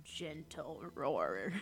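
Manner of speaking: very soft, slow, low echo; mock gentle roar. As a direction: tease it in a tiny, drawn-out growl. Teasing echo of the GM's phrase.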